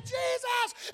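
high shouted name. Acted. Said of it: "And I grew a little louder".

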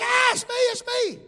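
high startled yelp of a scared man; startled cry. Retold or imitated. Mentor punched in the face, high startled yelp. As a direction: yelp it out in surprise. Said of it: "He was like".

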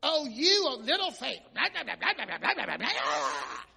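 mocking self-righteous whine; a mock pious scolding, tailing off; high-horse mockery. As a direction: sneer it from your high horse. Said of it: "We'd have got on our high horse".

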